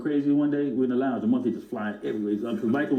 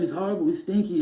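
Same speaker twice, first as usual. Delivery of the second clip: lightly voiced, higher mock whine: "He's horrible"; a hint of Michael, high and prissy; Michael complaining; 6 semitones higher than usual. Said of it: "stinky man".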